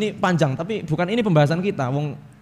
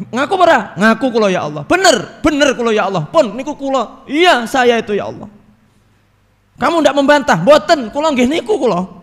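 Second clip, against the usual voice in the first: high, loud back-and-forth confession; loud heavenly interrogation; 6 semitones higher, 6 dB louder than usual. Acted. Preacher voicing Allah and sinner, loud and urgent. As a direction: play both judge and confessor.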